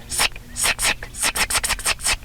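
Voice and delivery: unvoiced "sick" with no voice; breathy, quiet "sick" repeats; whispered sick. Shown as a demonstration. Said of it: "sick probably works better with no vocalization".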